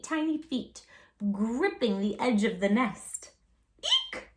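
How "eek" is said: very high eek of alarm; shocked shriek. Acted. Mama Bird seeing her babies hanging, shrill eek. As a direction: squeal in sudden fright. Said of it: "she cried".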